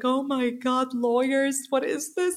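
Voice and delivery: high-pitched, acted alarm; mock panic. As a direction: send the voice way up in pretend dismay. Retold panic on hearing of lawyers.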